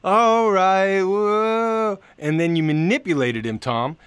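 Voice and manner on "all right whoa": mock Joey voice. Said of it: "Joey was like".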